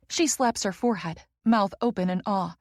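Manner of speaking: ordinary delivery, nothing marked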